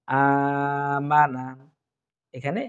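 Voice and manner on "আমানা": আমানা with the last "মা" held long and slow; drawn-out vowel. Shown as a demonstration. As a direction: stretch the alif in আমানা evenly. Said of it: "আলিফ টানবো". (আমানা শব্দে আলিফ টেনে ধীরে পড়া)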